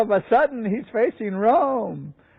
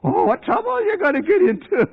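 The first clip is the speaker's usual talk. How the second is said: alarmed warning; 11 semitones higher than usual. Retold or imitated. Acted dire warning to Luther.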